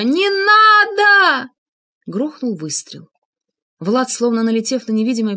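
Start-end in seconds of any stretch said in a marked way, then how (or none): 0.0-1.5 high, loud, drawn-out scream; frantic scream. A narrator's reading. Анна as the shot is about to fire, frantic scream. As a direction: scream it out desperately, stretching the words. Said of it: "как Анна истошно закричала".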